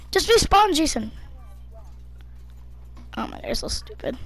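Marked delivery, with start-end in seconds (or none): 3.2-4.2 fast, whispered jab; whispered insult. Real, not acted. Kid annoyed at teammate, fast whisper. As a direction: mutter it under your breath.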